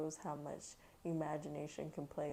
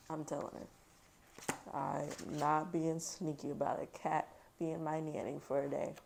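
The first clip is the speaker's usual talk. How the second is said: ordinary delivery, nothing marked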